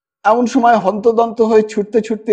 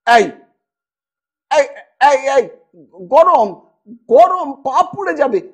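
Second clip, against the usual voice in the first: alarmed warning; 5 semitones higher than usual. A narrator's reading. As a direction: stop the child from the hot pot. Child near hot curry, alarm. (গরম থেকে শিশুকে সামলাতে আতঙ্কিত চিৎকার)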